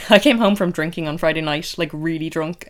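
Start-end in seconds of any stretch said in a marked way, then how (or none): none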